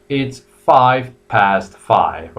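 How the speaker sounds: very slow, careful five past five; British time, slow. Shown as a demonstration. Teacher modelling British time for students, very slow and clear. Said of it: "And you have the British".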